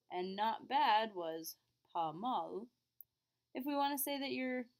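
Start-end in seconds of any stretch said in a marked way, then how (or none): 1.9-2.7 slow model. Shown as a demonstration. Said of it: "we do pronounce the L".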